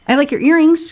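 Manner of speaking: bright high-pitched gushing compliment; high, sugary mock compliment; Cupcake's voice. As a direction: gush it up high like a fake-sweet girl. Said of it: "Cupcake might say".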